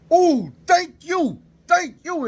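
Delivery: very high, slow drawn-out thanks; relieved gratitude. Retold or imitated. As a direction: gush with relief. Relief after huge burp.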